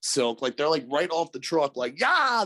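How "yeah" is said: high retold battle cry; charging cry. Firefighters jump right off the truck, high gung-ho cry.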